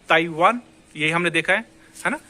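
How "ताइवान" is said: ताइवान with a W, "tai-waan"; Indian reading. Shown as a demonstration. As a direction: say ताइवान without any G. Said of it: "as a Indians इसको क्या पढ़ते हैं".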